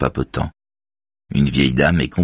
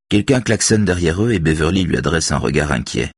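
usual speaking voice

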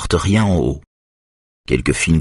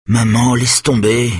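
slow, higher begging; pleading; 6 semitones higher, slower than usual. A narrator's reading. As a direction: beg her slowly to drop it. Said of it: "implore Benjamin".

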